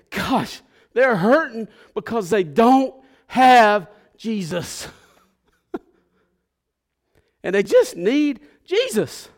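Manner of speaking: loud, high, impassioned lament; anguished preaching. Real, not acted. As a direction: cry out with an aching heart. Preacher overcome by ache for people.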